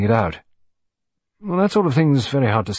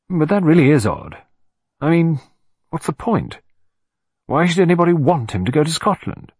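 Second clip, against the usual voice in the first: sudden puzzled outburst; 5 semitones higher than usual. Acted. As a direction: blurt it out as if the puzzle just struck. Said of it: "emerging suddenly to say".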